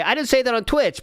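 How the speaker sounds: a light mock whine of his excuse; mocking imitation. Streamer mimics Philip denying it, lightly mocking. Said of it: "but he would say".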